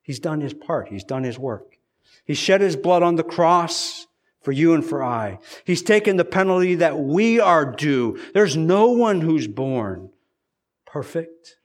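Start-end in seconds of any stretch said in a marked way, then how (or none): 2.2-11.6 loud, driving sermon climax; preaching at full force. Acted.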